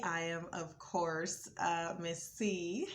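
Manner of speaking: ordinary talk, nothing marked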